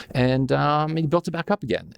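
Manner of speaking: usual speaking voice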